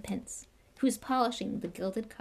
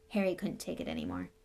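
ordinary delivery

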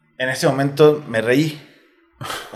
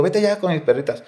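"Vete ya" pushed higher, urging her to go now; urgent repeat; 6 semitones higher, 3 dB quieter than usual. Retold or imitated. Repeating it to his daughter, higher and more urgent. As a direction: press the daughter to leave, pitch up on "vete".

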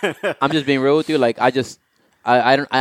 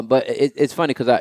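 ordinary delivery

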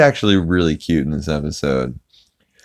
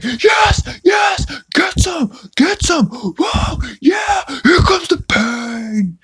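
very high, strained mock scream; Joe screaming; much slower than usual. Joe shrieking at a movie out of nowhere in bed, mock screaming. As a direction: squeal it like an ecstatic cartoon scream. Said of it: "you just hear Joe screaming".

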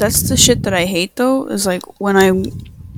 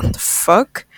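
retold disbelief; slower than usual. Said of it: "so I'm like".

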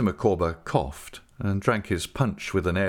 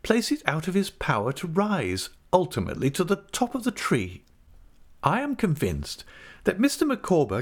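higher-pitched, emphatic wifely speechmaking; Mrs Micawber, confident; 7 semitones higher than usual. A narrator's reading. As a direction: declare it with grand conviction in a raised pitch.

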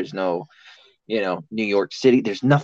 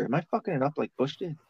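inner voice; 5 semitones higher, 8 dB quieter, faster than usual. Retold or imitated. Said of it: "I always wonder".

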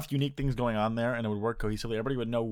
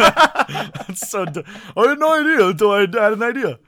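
laughing loudly; 10 semitones higher, 11 dB louder than usual. Real, not acted. Laughing at his own phrasing.

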